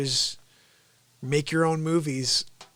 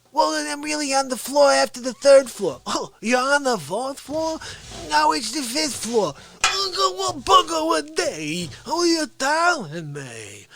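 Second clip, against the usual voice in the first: indignant character voice; 8 semitones higher than usual. Acted. Comic voice of angry customer.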